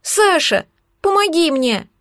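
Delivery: put-on high-pitched child pleading; small high plea for help; thin little voice. A narrator's reading. Child below begs Sasha for help, thin high voice. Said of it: "донёсся снизу тонкий голосок".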